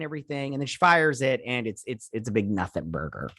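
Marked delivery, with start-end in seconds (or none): none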